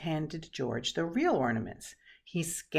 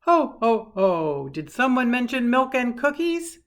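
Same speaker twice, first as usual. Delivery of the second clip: big, loud, booming Santa laugh and call; jolly Santa; 5 semitones higher, 11 dB louder than usual. A narrator's reading. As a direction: boom out a jolly Santa greeting. Santa enters the room.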